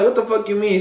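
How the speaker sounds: high, startled what the fuck you mean; recounting his own stunned reaction; shocked retelling. Retold or imitated. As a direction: pitch jumps up in disbelief. Reacting to marriage news.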